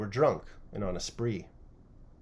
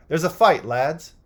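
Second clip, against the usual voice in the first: loud call to the lads; rally the men to the fight; alarmed call; 4 semitones higher, 7 dB louder than usual. A narrator's reading. Tall lad calling his mates to the porch fight, loud.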